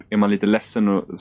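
normal talking voice

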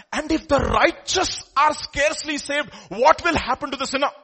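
forceful preacher's proclamation; full-force preaching. Real, not acted. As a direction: thunder the warning at the congregation.

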